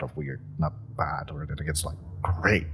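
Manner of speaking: whispered aside, embarrassed; flustered whisper at seeing his emotion; awkward whisper. Acted. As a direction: drop to a whisper, half to yourself.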